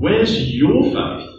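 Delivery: "your" punched high in a slow question; stress on YOUR. Shown as a demonstration. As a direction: slowly press "your", ending on a high rise. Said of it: "put the emphasis on your".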